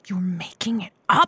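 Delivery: hushed whisper so nobody hears; shocked whisper. Acted. Co-host whispering to the fake vet, shocked whisper. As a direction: breathe it out, scandalized. Off-air secret during break.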